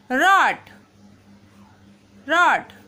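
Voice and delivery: रॉड said with a clear open aw; teacher repeats रॉड slowly and clearly; model word rod. Shown as a demonstration. Teacher drills रॉड for pupils, open aw vowel. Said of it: "इस तरह से हमें pronounce करना है".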